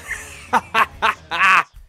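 staccato ha-ha laugh; dismissive laugh. Acted. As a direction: laugh it off in short bursts. Character laughs off suspicion.

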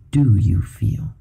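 slow, breathy whisper; whispered question. Acted. Gabriel gently probing Jet's feelings, whispered. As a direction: lean in and ask it secretly. Gabriel asks Jet intimately.

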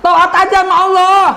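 high, loud, stressing Allah; loud cry of obedience; emphatic exclamation. Real, not acted. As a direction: call it out loud to the crowd. Preacher's key phrase repeated.

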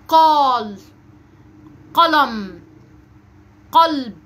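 loud heavy q starting "قال، قلم، قلب"; emphatic initial qaf. Shown as a demonstration. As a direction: model each word apart: "قال، قلم، قلب". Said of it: "هننطق كلمات تبدأ بصوت حرف القاف".

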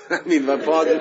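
laughing. Real, not acted. Laughing at war remark.

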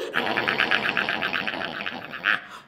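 low, loud doggy gnawing; long rough munching growl; pretend chewing noise. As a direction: chomp noisily like a puppy on a bone. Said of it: "Now chew!".

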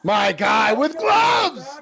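loud, high, slow hype shout; excited shout. Real, not acted. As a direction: cheer him on at full volume. Cheering an officer in footage.

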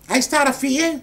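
very loud, sharp question; angry interrogation. Acted. Grilling the man about his phone call, angry and loud. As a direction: fire the question at full volume.

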